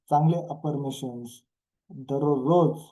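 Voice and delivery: usual speaking voice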